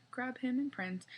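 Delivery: plain reading voice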